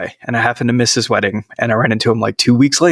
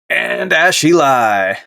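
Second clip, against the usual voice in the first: drawn-out, higher-pitched name announcement; announcer introduction; 4 semitones higher, much slower than usual. Acted. Host introduces the second co-host, drawn-out announcer voice.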